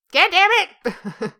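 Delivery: high, loud, drawn-out curse; exasperated outburst. Acted. Losing the job argument, exasperated outburst. Outburst after failing a comeback.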